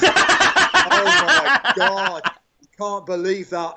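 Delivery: can't get the words out for laughing; real laughter while retelling a dressing-room joke; laughing through it. Real, not acted.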